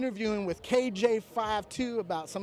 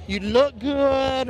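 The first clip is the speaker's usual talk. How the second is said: smug drawl; 3 semitones higher, 6 dB louder, much slower than usual. Pretend. Joke about looking good.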